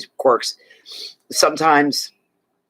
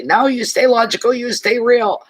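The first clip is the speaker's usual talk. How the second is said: higher-pitched, emphatic imitated advice; firm advice; 10 semitones higher than usual. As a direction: insist brightly they stay logical. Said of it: "I would say".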